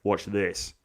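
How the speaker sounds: low, slow, soft swagger; cocky bully. Acted. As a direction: play the bully coolly showing off. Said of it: "He's like".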